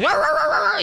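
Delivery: high yappy imitated barking roar; mock dog barking, pitched high; dog barking imitation. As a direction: bark like an excited dog. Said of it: "And then the dog".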